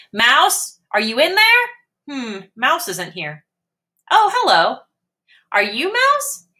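high, eager little kitten; a curious brave kitten calling out. Acted. Max the kitten voiced.

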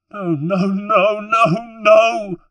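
very high, loud wail of grief; crying out. Acted. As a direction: wail each word in despair. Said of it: "cried Claudia".

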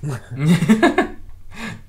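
big burst of laughter; loud laugh. Real, not acted. Laughing at opponent's play.

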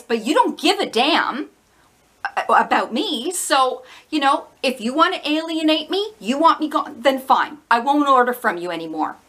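normal talking voice

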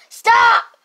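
loud high stop; shouting stop at the teaser; yelling stop. Acted. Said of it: "Why are you guys yelling in here?".

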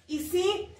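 ordinary talk, nothing marked

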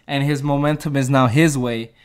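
ordinary talk, nothing marked

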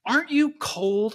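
high-pitched, pointed probing; warlock's testing question. Acted. Warlock testing a freezing girl, pointed high question. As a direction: press the freezing child with a question. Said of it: "he says".

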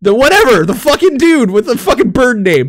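normal talking voice

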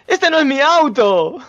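loud, high-pitched outburst; stunned realisation. Real, not acted. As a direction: cry out at a dawning blunder. Realises it is another car.

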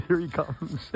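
high, talking through laughter; laughing. Real, not acted. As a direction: laugh through the words as the horse approaches. Amused as the horse approaches.

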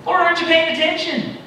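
high, loud exasperated appeal; exasperated cry. Acted. Habakkuk crying out at God, high and exasperated. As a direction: throw the question up to heaven. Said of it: "Habakkuk saying".

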